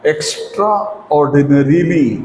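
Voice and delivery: "extraordinarily" drawn out part by part; slow, broken up. Shown as a demonstration. Teacher introducing a long word to learners, slowly in parts.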